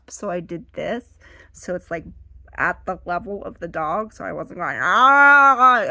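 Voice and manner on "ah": loud, high mock holler; exaggerated looming yell; intimidating approach. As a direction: come at the dog roaring. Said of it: "I wasn't doing that".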